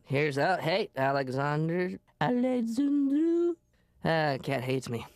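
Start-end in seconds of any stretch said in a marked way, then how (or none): 2.2-3.5 calling a cat. Acted.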